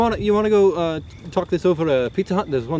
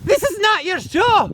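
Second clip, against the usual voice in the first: high-pitched, heated contradiction; outraged denial; 12 semitones higher than usual. Acted. Host denying the rival's claim to the show, outraged. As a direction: shut down your rival's claim. Acted quarrel over the show.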